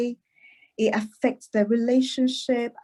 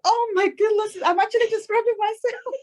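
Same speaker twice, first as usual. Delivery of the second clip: amused surprise, much higher and quicker; laughing realization; 11 semitones higher, faster than usual. Real, not acted. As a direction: catch yourself mid-laugh, delighted. Realizes she describes herself.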